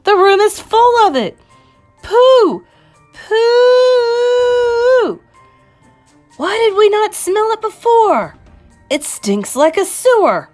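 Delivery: very high, loud wails of disgust; shrieking crowd revolted by a stench; shouted. Acted. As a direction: wail it, stretching the exclamations. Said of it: "they shouted".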